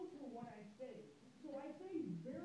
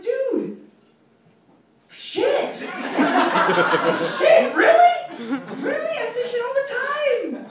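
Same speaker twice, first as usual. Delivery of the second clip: laughing disbelief; 10 semitones higher, 31 dB louder, slower than usual. Real, not acted. Caught swearing at boss.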